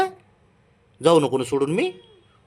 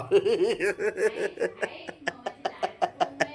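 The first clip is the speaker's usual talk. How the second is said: light laugh; 5 semitones higher, 5 dB quieter than usual. Real, not acted. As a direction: laugh at the scene. Other person laughs at coaxing.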